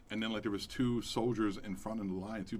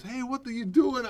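neighbor lady; 11 semitones higher, 5 dB louder than usual. Retold or imitated. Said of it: "this fucking lady comes out and goes".

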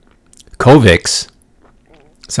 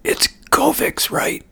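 slow unvoiced whisper; breathless whisper; 7 dB quieter, slower than usual. Patient on the ward to healthcare worker, whispering. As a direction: whisper with what little breath you have. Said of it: "and go".

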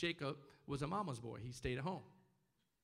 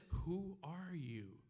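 very slow, softer drawn-out question; questioning Isaac; 5 dB quieter, much slower than usual. Acted. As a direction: stretch out each word as the puzzled old father. Acts blind Isaac questioning his son.

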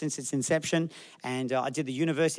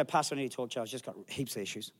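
lower, much softer mopey voice; mopey complaint; 5 semitones lower, 9 dB quieter than usual. Retold or imitated. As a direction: play the glum churchgoer after service. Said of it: "And then afterwards go".

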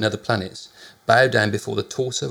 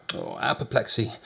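plain reading voice

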